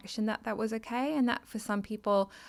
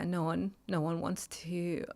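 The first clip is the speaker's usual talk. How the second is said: ordinary talk, nothing marked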